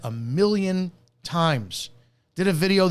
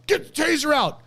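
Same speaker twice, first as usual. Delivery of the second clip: much higher shouted order; mock cop yell; 13 semitones higher than usual. Imitating a cop yelling orders mid-fight, urgent shout. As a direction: bark the order like a panicked cop.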